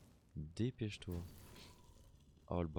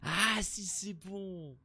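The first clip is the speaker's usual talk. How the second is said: lightly voiced seer; 13 semitones higher, much slower than usual. Continues the put-on voice.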